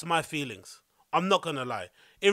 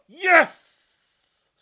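high, loud shout of joy; excited cheer; 10 semitones higher, 9 dB louder than usual. Real, not acted. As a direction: cheer like you just got booked. Celebrating DJ booking.

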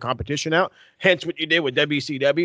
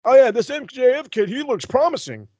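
mocking fan voice; 8 semitones higher than usual. Mocking fans of the other show.

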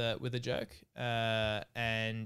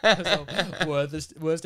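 laughing while talking; 4 semitones higher, 6 dB louder, much slower than usual. Real, not acted. Teller laughs at friend's terrible accent, laughing. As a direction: laugh through the words.

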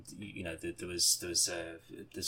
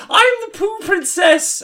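very high, loud, slow princess squeal; silly princess voice; 11 dB louder than usual. Retold or imitated. Mark skipping on as the poo princess, very high squeal. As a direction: announce yourself as a dainty princess, squealing. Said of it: "he's like".

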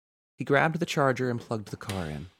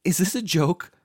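high, loud alarmed question; shaking voice; 10 semitones higher, 9 dB louder than usual. A narrator's reading. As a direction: demand it in rising fear. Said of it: "her voice shaking".

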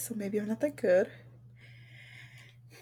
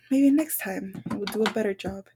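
normal talking voice

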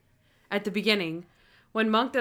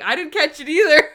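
high, louder retold exclaim; mock exclaim; 6 semitones higher, 9 dB louder than usual. Friend blurting out she missed it too. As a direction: blurt it out, amused.

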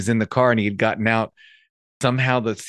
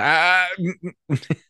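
flustered stammering; 9 semitones higher, slower than usual. Retold or imitated. Lost when Spanish comes back.

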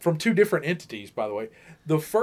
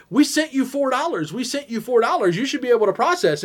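higher-pitched imitation of pestering scammers; mock insistent complaint; 7 semitones higher than usual. Scammers chasing their four dollars, mock insistent complaint.

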